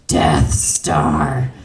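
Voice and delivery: very low, menacing growl; ominous announcer voice. Acted. Diner naming the hottest wing flavor, ominous low growl. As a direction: growl it like a dreaded name.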